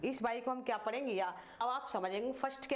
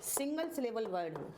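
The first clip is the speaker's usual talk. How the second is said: measured phrases with long pauses and repeats. Shown as a demonstration. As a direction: dictate it bit by bit for copying. Dictates a rule while writing.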